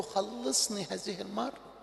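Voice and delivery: soft, low, broken-hearted plea; weary prayer. Acted. Preacher voicing a tired sinner's prayer to God, soft and weary. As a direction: pray it quietly like an exhausted son.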